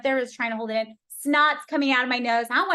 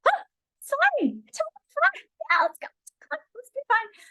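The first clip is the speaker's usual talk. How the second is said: much higher, brittle fake-cheerful voice; forced fine. Brushing off the bleachers seat, forced high fake cheer. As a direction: pretend everything's fine while hurt. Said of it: "So I was like".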